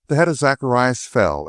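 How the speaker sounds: plain reading voice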